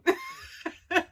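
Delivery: squealing high laugh. Real, not acted. Vlogger laughing at the jealous character, high laugh.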